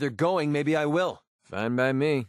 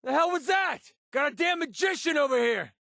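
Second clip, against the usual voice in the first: high-pitched, incredulous yelling; shouted outburst at an enemy's trick; exasperated combat shout. Acted. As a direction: yell in disbelief mid-fight. Combat barks, startled and exasperated.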